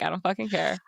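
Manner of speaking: ordinary delivery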